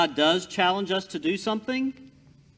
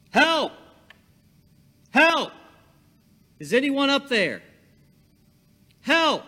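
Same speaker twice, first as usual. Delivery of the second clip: shouted much higher and louder, desperate; desperate yell for help; 12 semitones higher, 8 dB louder than usual. Acted. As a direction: scream up the cliff for rescue. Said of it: "So Jack began yelling".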